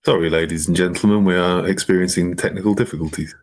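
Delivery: airline captain making a cabin announcement; pilot voice. Pretend. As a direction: speak like the captain over the PA. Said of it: "A lovely pilot voice".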